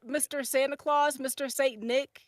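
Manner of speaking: normal talking voice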